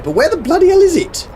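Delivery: high, exasperated outburst. Real, not acted. Searching the map for the plant, exasperated and high. Can't find the trading platform.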